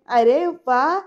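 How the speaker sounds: loud delighted exclamation; pleasant surprise. Retold or imitated. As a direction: sound happily surprised. Vallari आश्चर्याने कौतुक करते. (आनंदाने आश्चर्य)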